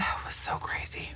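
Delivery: soft whispered aside; whispering. Real, not acted. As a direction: whisper it in disbelief. Whispered aside about plot.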